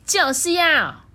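high, loud stubborn retort; stubborn princess. A narrator's reading. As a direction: insist like a spoiled princess. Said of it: "顶嘴公主就会说".

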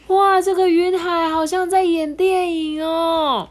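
high, loud, slow delighted voice; children marvelling at the sea of clouds; happy cheer. Acted. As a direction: gasp in wonder. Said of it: "快乐欢呼哎".

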